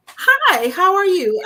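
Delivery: high, loud put-on cheerful hello; bright fake greeting. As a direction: sound sweet and innocent on the phone.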